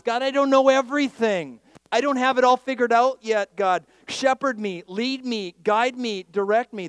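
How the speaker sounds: loud, high plea for guidance; urgent prayer. Acted. Preacher voices the psalmist asking God to guide him, urgent plea. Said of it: "That's what he's saying".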